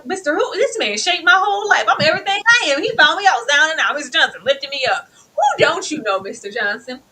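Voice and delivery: high, loud mock testimony; proud hammed-up boast. Hammed-up praise of the man who lifted him up. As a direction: gush like a grateful fan.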